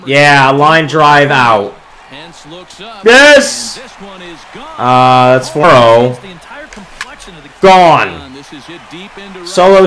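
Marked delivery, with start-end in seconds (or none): 3.0-3.8 sudden happy shout; excited yes. Real, not acted. Gamer cheering his own home run. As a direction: cheer the homer.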